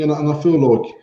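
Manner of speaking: ordinary talk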